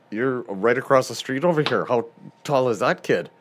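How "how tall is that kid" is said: usual speaking voice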